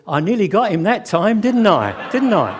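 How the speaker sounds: loud, exasperated sarcasm to the umpire; angry bowler. Acted. Furious bowler turning round to the umpire, loudly indignant. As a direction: throw it hard at the umpire as a punchline. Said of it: "Larwood is really angry now".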